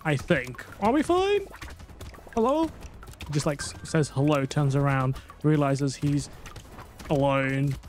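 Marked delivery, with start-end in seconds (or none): 0.8-2.7 very high, squeaky questioning; high-pitched calling out. Pretend. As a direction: call out high into an empty room. Calls out as if alone.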